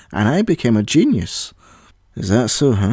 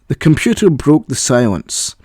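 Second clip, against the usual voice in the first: usual speaking voice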